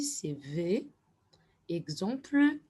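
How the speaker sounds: normal talking voice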